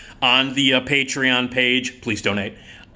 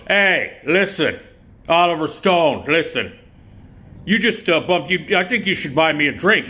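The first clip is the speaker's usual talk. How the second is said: higher, pushy buddy voice; Joe Donatello; 5 semitones higher than usual. Acted. Buddy tells drunk director to buy a drink, pushy impression. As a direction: demand attention in short pushy bursts. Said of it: "so Joe turns around, sees him, and goes".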